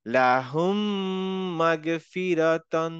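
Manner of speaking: লাহুম মাগফিরাতান with m held two counts through the nose, slow; merged mim with ghunna. Shown as a demonstration. Teacher models the correct joined reading. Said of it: "টু count time নিলাম".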